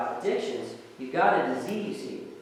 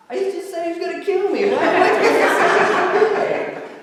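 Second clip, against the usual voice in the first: high, loud, laughing disbelief; incredulous laughing; 10 dB louder than usual. Retold or imitated. Retelling his own shock to the host, laughing incredulously.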